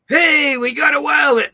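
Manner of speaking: high, loud triumphant cry; gleeful mock shout. Mocking seventies film characters, a high loud triumphant shout. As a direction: crow it like a smug outlaw who got away.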